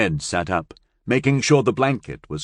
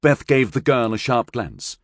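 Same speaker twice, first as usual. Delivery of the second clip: ordinary delivery, nothing marked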